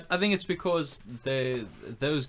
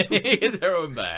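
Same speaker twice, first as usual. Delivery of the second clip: slow, high, louder words through laughter; laughing; 6 semitones higher, 6 dB louder, slower than usual. Real, not acted. Friend repeating the punchline, slow and laughing. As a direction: draw it out while cracking up. Echoing the joke while laughing.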